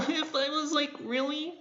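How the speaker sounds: high, soft and timid; shy meek voice. Shown as a demonstration. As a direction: sound small and scared. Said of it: "I sounded like this".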